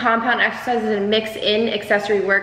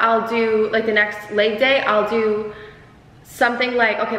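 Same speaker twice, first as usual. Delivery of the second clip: normal talking voice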